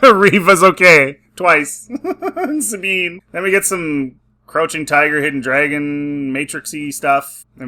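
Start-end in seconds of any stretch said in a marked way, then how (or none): 0.0-3.2 talking through laughter; laughing. Real, not acted. Reviewer ridicules characters surviving lightsaber wounds, laughing through the words. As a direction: crack up while listing them.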